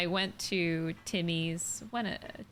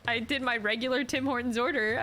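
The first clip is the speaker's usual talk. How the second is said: ordinary talk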